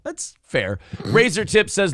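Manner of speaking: ordinary talk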